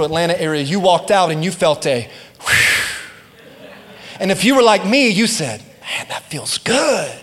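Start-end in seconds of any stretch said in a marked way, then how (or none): 2.4-3.7 long breathy whoosh of wind. Retold or imitated. Preacher imitating the morning breeze, a long whoosh. 5.6-7.1 slow, savouring sigh of relief; acted relief. Churchgoer stepping into cool morning air, relieved and savouring.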